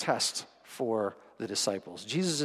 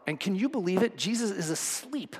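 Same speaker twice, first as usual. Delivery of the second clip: high, excited disbelief with a laugh; gossiping fisherman, can't believe it; amazed retelling; 7 semitones higher than usual. Retold or imitated. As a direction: Peter marvelling to Mark, pitch up. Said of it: "Peter's, you know, telling Mark".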